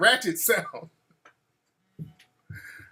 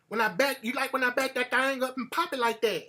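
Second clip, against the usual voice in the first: put-on country girl voice; 4 semitones higher, 5 dB quieter than usual. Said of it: "talking that country".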